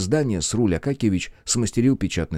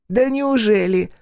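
high, slow mock disbelief; smirking; 13 semitones higher, 4 dB louder, slower than usual. A narrator's reading. Vampire doubts the hero's death, smirking. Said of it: "усмехнулся вампир".

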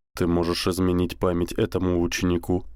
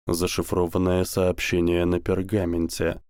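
usual speaking voice

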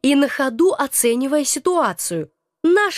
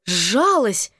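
plain reading voice

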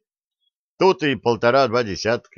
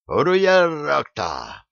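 war cry; 6 semitones higher, much slower than usual. A narrator's reading. Bandit's war cry in fight.

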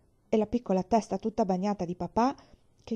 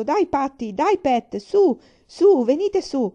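building, louder and higher yells to the horses; urging shout; 5 semitones higher, 8 dB louder, slower than usual. A narrator's reading. Father yelling at the horses to climb the bank. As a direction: drive the horses up the bank with your voice.

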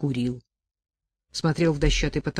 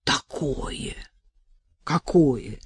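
usual speaking voice

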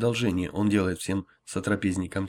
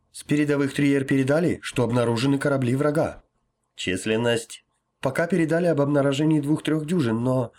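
urgent report; 5 semitones higher, 5 dB louder than usual. Acted. Helmsman reports enemy sighted.

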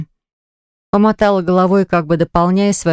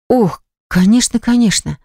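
higher, fussy and flustered; 5 semitones higher than usual. A narrator's reading. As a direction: hurry to agree, a bit flustered. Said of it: "засуетилась я".

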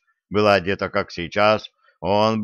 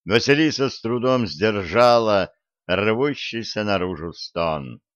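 usual speaking voice

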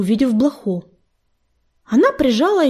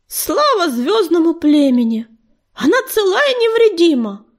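high, loud cry of relief; excited relief; 7 semitones higher, 4 dB louder than usual. A narrator's reading. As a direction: call it out, overjoyed. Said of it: "взволнованный голос огнезвёзда".

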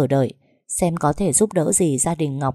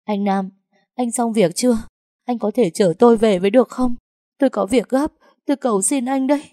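high, breaking plea through tears; tearful pleading; 6 semitones higher than usual. Acted. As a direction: beg him urgently, close to sobbing. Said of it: "nước mắt ngắn dài". (giọng van nài nghẹn ngào, cao vút)

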